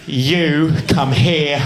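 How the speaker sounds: put-on slow English-accented command. Airport guard ordering a traveller over, slow mock English accent. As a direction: summon someone as an English official. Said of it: "I'll just make him English".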